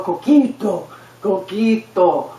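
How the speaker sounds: "coquito" drawn out with Spanish vowels; relative's correction. Acted. Relative demonstrating the difference, "Coquito" slow and Spanish. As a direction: model "Coquito" slowly, stretching the KEE. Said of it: "Do you hear the difference?".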